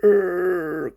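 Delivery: high grating wheel screech; imitated screech. Wheel screeching as the donkey bolts, high grating noise. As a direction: mimic the jammed wheel squealing.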